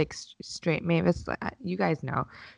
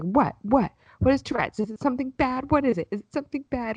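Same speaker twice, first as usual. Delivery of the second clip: put-on much higher, worried little girl asking; small child voice; 7 semitones higher than usual. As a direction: play an anxious six-year-old. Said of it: "Mavis was like".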